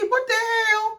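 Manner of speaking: very high-pitched, drawn-out exclamation; shocked. Retold or imitated. Viewer as shocked as the two friends, high-pitched disbelief. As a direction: squeal it in mock shock. Louder repeat of shocked reaction.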